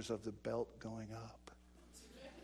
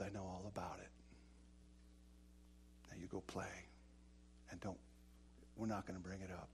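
gentle forgiving father; 5 semitones lower, 11 dB quieter than usual. Retold or imitated. Father forgiving his sons after the spanking, tender and soft. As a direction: soothe the boys like a forgiving dad. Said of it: "And he says".

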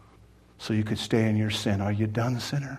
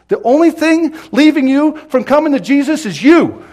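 high, loud pulpit cry; full-force preaching; 13 semitones higher, 12 dB louder than usual. Real, not acted. Preacher confronting the unsaved listener, full-force preaching.

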